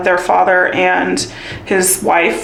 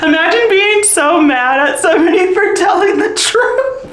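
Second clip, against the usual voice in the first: laughing; 9 semitones higher than usual. Real, not acted. Laughing about ex's anger.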